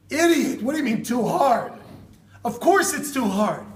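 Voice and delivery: loud, high, incredulous outburst; exasperated scolding. Acted. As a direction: berate him, punching words slowly. Acted scolding of the digger.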